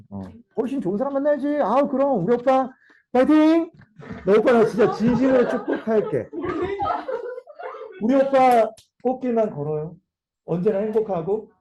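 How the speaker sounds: high, overbright send-off; feigned cheerful blessing. Acted. Jilted lover sending boyfriend off, acted cheerful blessing. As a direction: cheer the ex on with put-on enthusiasm. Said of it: "그 꽃이 내 마음이야".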